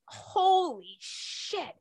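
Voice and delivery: gasping amazement at the donation; stunned disbelief. Real, not acted. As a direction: let it fall out of you in awe. Stunned by Oprah's matching donation.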